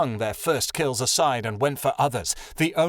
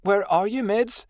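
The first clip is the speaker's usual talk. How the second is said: high questioning call; searching call; 8 semitones higher than usual. A narrator's reading. Adelaide wakes to find her friend gone, calling high.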